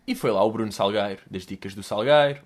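ordinary delivery

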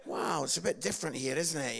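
ordinary talk

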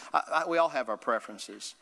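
normal talking voice

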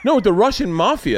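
high, insistent retold correction; acted exasperated parent.

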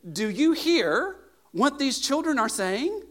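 loud, high, slow challenge; indignant. Acted. Priests to Jesus about the children, indignant. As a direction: demand an answer, stretching the words out. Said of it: "were indignant".

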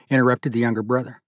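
ordinary delivery, nothing marked

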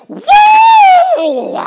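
long, piercing high "woo"; loud, high woo dropping off at the end; Ric Flair wrestler whoop. Retold or imitated. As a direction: let out a huge wrestler's entrance whoop. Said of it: "he would come out like this".

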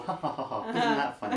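high, jokey fake laugh; mock laughing. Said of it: "in a nicer way".

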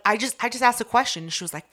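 ordinary delivery

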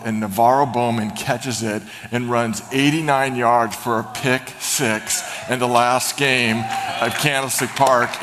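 normal talking voice

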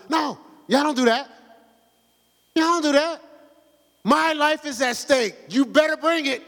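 preaching rebuke at full push; forceful rebuke. Real, not acted. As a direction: drive it home hard.

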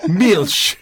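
Milk said the German way as Milch; German accent. Shown as a demonstration.

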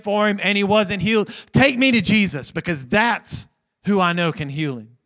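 loud, high preaching at the climax; fervent climax of the sermon; full-force preaching. Acted. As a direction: preach it with everything.

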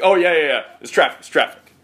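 dismissive New York cabbie brush-off; New York accent. Retold or imitated. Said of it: "such an aggressive New York accent".

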